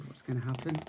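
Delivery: usual speaking voice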